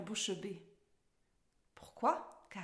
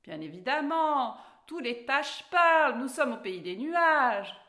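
slow, emphatic, louder statement; emphatic assertion; 4 semitones higher, 7 dB louder than usual. A narrator's reading. Cat tells Alice all cats talk here, asserting it. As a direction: stretch it out as if obvious. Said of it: "affirma le chat".

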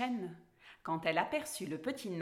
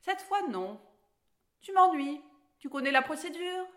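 higher-pitched irritation; a touch of grumpy impatience, pitched up; annoyed dwarf; 6 semitones higher, 6 dB louder than usual. A narrator's reading. Grumpy dwarf answers Alice's question about conditions, annoyed. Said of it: "très en colère".

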